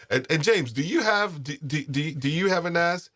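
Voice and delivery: excited high question. Real, not acted.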